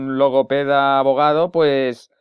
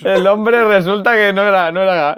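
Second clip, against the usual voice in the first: giggling through the words; cracking up while talking; laughing; 6 semitones higher, 3 dB louder than usual. Real, not acted. Laughing over the prank caller.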